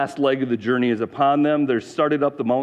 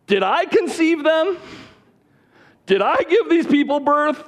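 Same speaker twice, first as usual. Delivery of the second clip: high, strained complaint at God; fed-up leader venting at God, pitched high; exasperated outburst; 10 semitones higher than usual. Retold or imitated. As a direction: throw up your hands and let God have it. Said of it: "Moses goes off on God".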